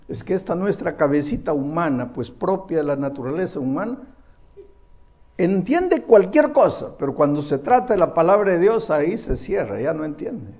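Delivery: usual speaking voice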